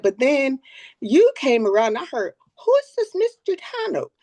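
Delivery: a hint of an eleven-year-old; imitating a child. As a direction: mimic the grandson's eager speech. Grandson's speech retold by grandma.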